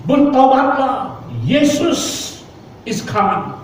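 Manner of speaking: high, slow, drawn-out call to repent; old man urging passengers to repent, high slow proclaiming call. Retold or imitated. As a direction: call out to the whole train carriage, slow and high. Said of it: "Dia berdiri dan berkata".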